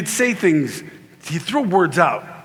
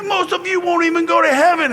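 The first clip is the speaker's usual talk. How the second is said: high, strained mock-preacher cry; hammed-up high-pitched condemnation; hellfire preacher; 11 semitones higher than usual. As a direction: warn the flock in a high preaching voice. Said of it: "And he's like".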